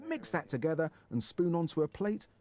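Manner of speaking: measured, clearly enunciated recipe read; recipe voice-over. Acted. Presenter takes viewers through the dish, recipe voice-over. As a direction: read out the method step by step.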